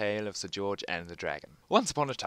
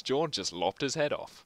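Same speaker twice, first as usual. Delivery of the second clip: ordinary talk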